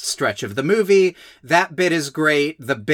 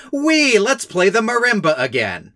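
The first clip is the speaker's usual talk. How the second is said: higher, gleeful mock cheer; 6 semitones higher than usual. Podcaster mimics the quirky family carrying on regardless, mock gleeful. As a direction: imitate carefree, oblivious quirky people. Mocking the quirky characters' glee.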